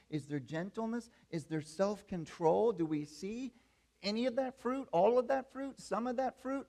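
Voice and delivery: impassioned sermon delivery; full-force preaching. Acted. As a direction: drive the message home to the church.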